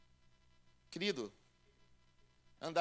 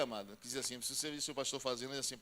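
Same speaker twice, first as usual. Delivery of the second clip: ordinary talk, nothing marked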